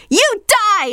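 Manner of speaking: high, loud shriek; shouting. A narrator's reading. Sister hurls the phone, shrieking high and loud. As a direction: scream it at her. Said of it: "She picked up the telephone and shouted".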